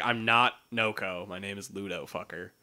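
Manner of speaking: low, muttered grumble, dropping very soft; grumpy dog knight. Retold or imitated. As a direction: mutter the insult under your breath. Dog insisting on his name.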